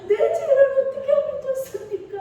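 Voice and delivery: high, sung, wailing plea; a keening cry for mercy, pitched high; wailing lament. Shown as a demonstration. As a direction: wail it out as if sobbing in repentance. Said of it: "통곡하며 소리 내어서 울었다".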